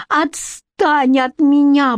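high, drawn-out protest; cried while fleeing. A narrator's reading. Queen flees her rival, high cry. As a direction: cry out to fend off a pursuer. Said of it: "бросила на бегу королева".

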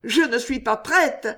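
attempted resistance. A narrator's reading. Jeanne still trying to resist the wedding, protesting. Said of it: "essaya de résister encore la jeune fille".

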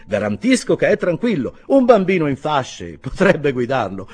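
loud, high stableman's voice; hearty stableman. A narrator's reading. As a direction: boom out reassurance to a customer. Stableman vouches for the horse. (stalliere a gran voce)

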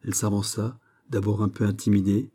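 ordinary delivery

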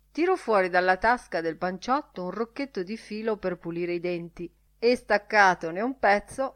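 plain reading voice

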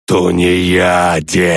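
slow, drawn-out roar of the dead; roaring undead chorus. Acted. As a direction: roar it like a horde of corpses. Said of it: "взревели корабелы".

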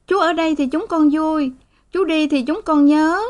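put-on high little girl's voice, tearful plea; pleading child. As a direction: beg papa like a small girl. (giọng bé gái van nài)

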